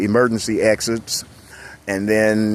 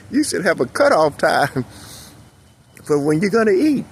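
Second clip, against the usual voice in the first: ordinary delivery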